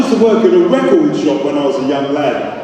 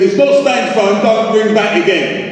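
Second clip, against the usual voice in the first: higher-pitched exasperation, slowing on the last words; aggravated, pitched up and drawn out at the end; 6 semitones higher than usual. Acted. Man fed up with a repeat prank caller, aggravated. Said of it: "That's aggravated".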